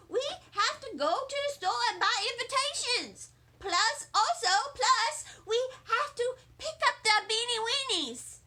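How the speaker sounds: tug mom along impatiently; eager child pulling at mother; pleading little girl. Acted. Junie urges mother.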